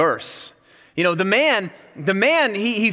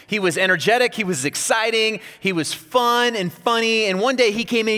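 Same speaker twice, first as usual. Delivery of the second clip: normal talking voice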